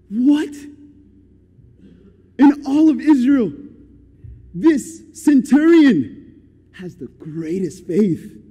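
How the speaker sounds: stunned "what?" rising into emphatic marvel; amazed. Retold or imitated. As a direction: exclaim it, moved and astonished. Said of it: "Jesus is moved".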